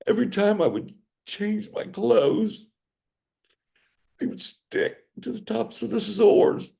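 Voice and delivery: real crying, strained up high; real tearful anguish. Real, not acted. Tearful account of unending suffering.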